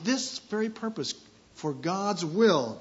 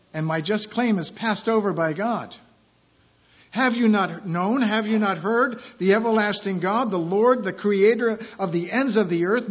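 loud, raised proclaiming of scripture; scripture reading; 5 semitones higher, 7 dB louder than usual. Acted. Pastor reading Philippians to church, loud proclaiming. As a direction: declare the verse to the room. Reads scripture aloud in sermon.

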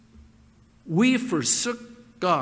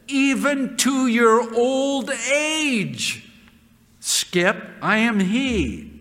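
scripture reading; 3 semitones higher than usual. Acted. Preacher voicing God to his congregation, solemn.

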